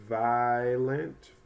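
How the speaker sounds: "violent" stretched as "VYE... lent"; long vowel sound. Shown as a demonstration. Teacher trying another vowel sound, drawn-out long i. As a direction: draw out the long i in "violent", slowly. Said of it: "try a long vowel sound".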